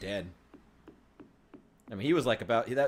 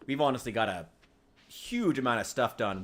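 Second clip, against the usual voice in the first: ordinary delivery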